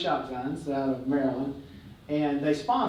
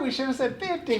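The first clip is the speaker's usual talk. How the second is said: high, laughing groan of regret; mock kicking himself, laughing; 10 semitones higher than usual. Realizing they asked too little, laughing regret.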